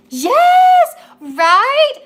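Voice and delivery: high, loud burst of yes with a laugh; excited shout. Real, not acted. Reader reacting to a viewer's comment, loud and excited. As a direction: yell it out, thrilled and laughing.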